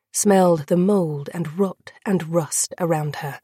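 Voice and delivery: ordinary delivery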